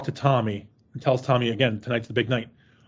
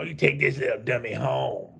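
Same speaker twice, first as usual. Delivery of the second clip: Grandpa impression, mocking; 2 semitones lower, 5 dB quieter than usual. Podcast host acting out Grandpa's orders, lightly mocking. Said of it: "Grandpa is all like".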